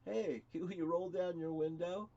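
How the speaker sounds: lightly voiced police officer request; mild imitation of the cop at the door; cop asking. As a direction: ask like a cop at the car window. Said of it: "they go".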